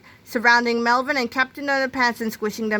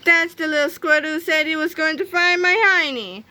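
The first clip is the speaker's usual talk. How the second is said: higher-pitched accusation; accusing cry; 7 semitones higher than usual. A narrator's reading. Miss Anthrope identifies Melvin to the crowd, indignant cry. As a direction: point out the culprit indignantly. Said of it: "cried Miss Anthrope".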